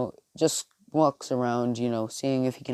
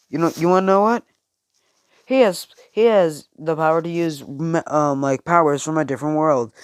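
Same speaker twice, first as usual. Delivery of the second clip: higher, louder, quick boastful rush; excited explaining; 4 semitones higher, 5 dB louder than usual. Acted. Speaker telling Bakugo the powers come from another world, excited. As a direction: sell the big reveal.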